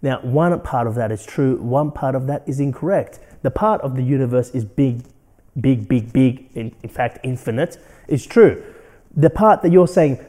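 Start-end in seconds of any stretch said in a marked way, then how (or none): none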